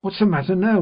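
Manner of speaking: sharper, high and loud demand. A narrator's reading. Sister losing patience, demanding an answer sharply. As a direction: bite the words off harder. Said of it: "repeated my sister more sharply than before".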